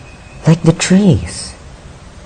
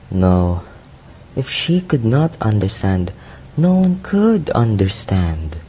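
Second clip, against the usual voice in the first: dejected; 2 semitones lower, 4 dB quieter than usual. Acted. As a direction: speak softly, sinking into disillusion. Youth despairs of love and life.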